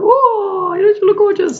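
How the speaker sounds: high, loud silly clown voice; squeaky playful clown greeting. Retold or imitated. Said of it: "I go".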